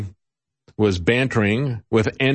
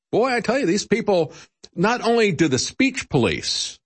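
ordinary talk

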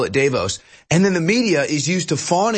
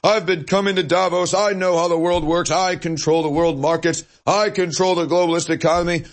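higher, smug bragging voice; higher-pitched hammed-up snob; pompous Davos elite; 3 semitones higher than usual. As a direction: play a self-important old elite. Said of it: "that are".

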